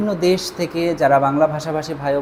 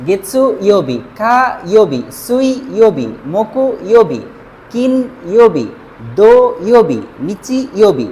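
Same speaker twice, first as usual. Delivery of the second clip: each day name louder, with a clear fall; drill recitation; 2 semitones higher, 5 dB louder than usual. Shown as a demonstration. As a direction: recite the full names like a classroom drill. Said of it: "অর্থাৎ".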